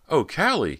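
high, loud cry of feeling; emotional exclamation. A narrator's reading. Girl finding her long-lost sister, emotional exclamation. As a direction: exclaim the name, overcome with emotion. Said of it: "her voice trembling with emotion".

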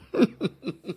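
high little giggle; string of light, high chuckles; playful giggle. Real, not acted. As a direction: giggle after a cheeky confession. Laughs after claiming her crush.

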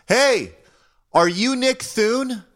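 high, drawn-out call from across the street; retold yell. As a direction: imitate someone hollering from far off. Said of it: "somebody yell out".

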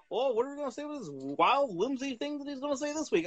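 high, mocking announcer voice; high sing-song mock of the announcer; mocking Michael Cole. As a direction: parody a corny commentator's hype.

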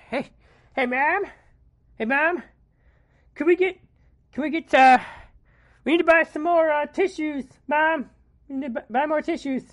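put-on high, loud whining kid; whiny child voice. Child begging his mother.

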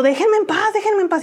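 high, pleading cry to be left alone; desperate plea. Retold or imitated. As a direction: beg them to stop, voice pushed up high. Bullied girl pleading at classmates. (súplica alta y desesperada: "Déjenme en paz")

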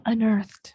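"unearthed" run together as one word; linked pronunciation. Shown as a demonstration. Host gives the linked example word, said as one smooth "unearthed". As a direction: link on into earth in "unearthed", effortless. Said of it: "把on跟earth连起来了".

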